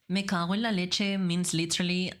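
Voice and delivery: normal talking voice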